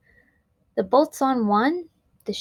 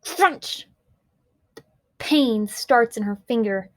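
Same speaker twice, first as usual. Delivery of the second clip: usual speaking voice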